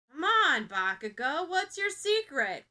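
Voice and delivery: loud nosy wheedling; eager prodding. A narrator's reading. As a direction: pester him for the secret. Kaminari prodding Bakugo.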